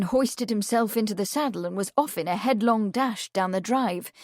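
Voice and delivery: ordinary delivery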